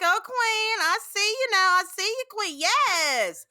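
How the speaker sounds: high, loud sassy queen voice; showing how regulars talk at drag brunch. Shown as a demonstration. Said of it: "that's how we talk when we're there".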